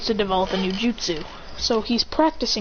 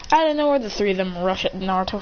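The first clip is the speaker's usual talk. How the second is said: ordinary talk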